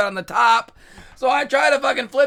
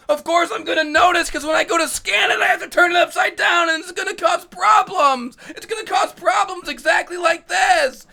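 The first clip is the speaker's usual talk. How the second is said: furious rant; 5 semitones higher than usual. Real, not acted. Ranting about the barcode on top, in a furious shout. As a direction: let the outrage build and boil over.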